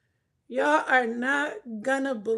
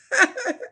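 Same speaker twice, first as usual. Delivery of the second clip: high, loud laugh; a shrill burst of laughter; 11 semitones higher, 5 dB louder than usual. Real, not acted. Laughs at cat.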